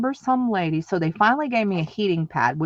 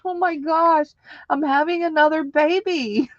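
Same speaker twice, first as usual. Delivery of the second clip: much higher, alarmed exclamation; mock panic; 9 semitones higher than usual. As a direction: replay the shock of it. Retelling shock at second push.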